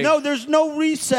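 high, insistent refusal; insistent objection. Acted. Host refusing the lawyers a recess, insistent objection. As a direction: cut across the chatter, pitched right up.

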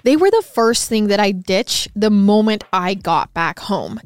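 upbeat, loud sponsor read. Acted. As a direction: sell it like a hosted podcast ad.

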